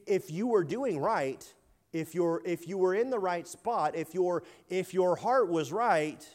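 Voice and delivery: high, loud, impassioned preaching voice; preaching hard, pleading with Cain through the text; fervent preaching. Acted. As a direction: press the point home to the congregation. Preaches Cain passage with fervour.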